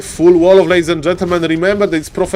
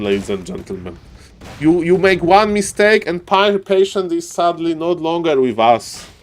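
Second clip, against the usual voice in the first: ordinary delivery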